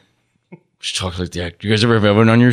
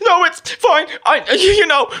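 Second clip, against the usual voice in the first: much higher, put-on voice brushing off her tears; mock brave high voice waving it away; Wendy Williams impression. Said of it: "she's like".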